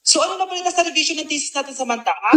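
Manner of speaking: quiet repeat. Acted. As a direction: mutter the question again under breath. Repeated line much softer, faster.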